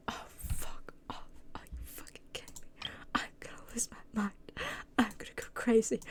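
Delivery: whispered swearing under the breath; frustrated whisper. Real, not acted. Gamer whispering curses at a dragging quest, hushed. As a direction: hiss it quietly in exasperation.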